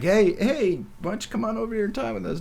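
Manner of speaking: high, fast come-on; smooth buddy's invite. Retold or imitated. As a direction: beckon her over, smooth and quick. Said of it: "but he's like".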